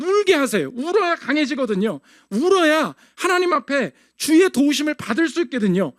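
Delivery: ordinary delivery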